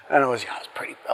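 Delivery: whispered, almost no voice; hushed. Real, not acted. Recalling the premature baby boy, whispered and hushed. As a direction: drop to a whisper at the grim memory.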